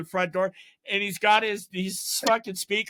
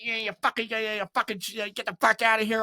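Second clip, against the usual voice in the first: mock mad, higher-pitched sputtering; imitated anger; 4 semitones higher than usual. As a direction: sputter like someone angrily throwing people out. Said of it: "all mad".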